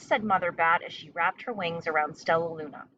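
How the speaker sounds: ordinary delivery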